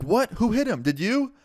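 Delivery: quick shocked questions, voice jumping up high; alarmed questioning. Retold or imitated. Teacher finding a colleague knocked out, alarmed.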